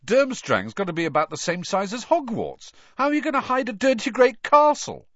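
high, incredulous voice; amused disbelief. A narrator's reading. Boy ridiculing idea of hidden castle, incredulous. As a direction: build to a loud, scoffing question. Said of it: "starting to laugh".

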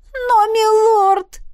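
very high, drawn-out tearful protest; tearful exclamation. A narrator's reading. Weeping princess protests to the healer, tearfully exclaiming. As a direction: wail it at the healer through tears. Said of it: "воскликнула Аварилиан, размазывая по лицу слёзы".

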